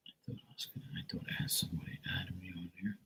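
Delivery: whispered aside to herself; breathy muttered whisper. Real, not acted.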